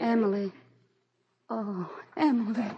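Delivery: crying relief at her sister; tearful greeting. Acted. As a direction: near tears. Meeting sister, crying heard.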